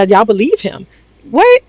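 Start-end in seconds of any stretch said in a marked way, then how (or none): 1.3-1.6 shrill, high what of disbelief; a squeaked what, far up; stunned what. Real, not acted. Vlogger reacting to the cast, stunned. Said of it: "her own disbelief".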